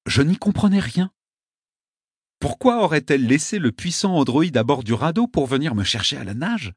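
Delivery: ordinary delivery, nothing marked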